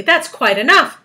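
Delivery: high, loud, indignant protest; sharp. A narrator's reading. Earthworm refusing to be bait, sharp. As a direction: put a stop to it sharply. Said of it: "the earthworm said sharply".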